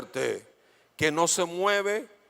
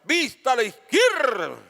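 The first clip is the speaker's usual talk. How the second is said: louder, higher drill order; command call; 6 semitones higher, 5 dB louder, slower than usual. Retold or imitated. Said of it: "una voz de mando que era". (voz de mando militar)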